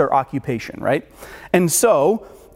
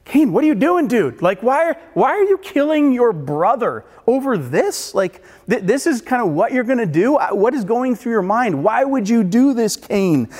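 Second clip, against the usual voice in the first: pleading bystander; 6 semitones higher than usual. Pretend. A shocked onlooker calling out to Cain about killing his brother, high and disbelieving. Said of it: "and so you're like".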